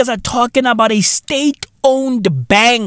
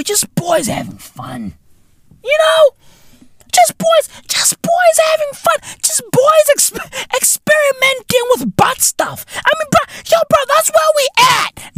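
mocking falsetto. Mocking the minister's son and boyfriend in high falsetto. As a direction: squeal it out in a mock singsong falsetto. Mocking voice about boys fun.

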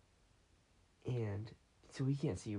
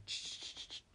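imitated rustling; 9 dB quieter than usual. Rummaging noise imitated.

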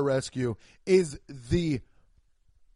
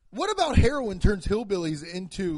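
ordinary talk, nothing marked

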